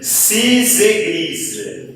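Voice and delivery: "cis église" drawn out slowly with a z link; teacher modelling liaison for students; slow liaison demo. Shown as a demonstration. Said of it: "Pronúncia cis".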